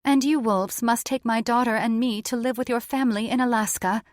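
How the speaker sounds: high, quick djinn voice; brisk, high-pitched demand. A narrator's reading. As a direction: lay down terms as the djinn.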